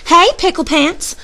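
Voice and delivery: high-pitched holler; mama calling out to her boy. A narrator's reading. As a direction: call out high to a kid running off. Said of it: "hollered Mama".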